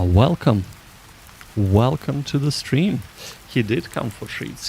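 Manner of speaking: usual speaking voice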